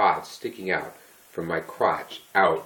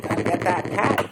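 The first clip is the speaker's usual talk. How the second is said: dog's voice; 11 semitones higher, 3 dB louder than usual. Pretend. Voicing the dog's thoughts.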